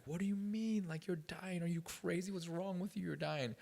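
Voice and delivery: high, frantic mock aunt voice; shrill-pitched panicked relatives, hammed up. Nephew mimics his crying aunts, in a high frantic voice. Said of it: "my aunts, they're crazy".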